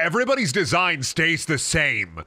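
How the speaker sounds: ordinary talk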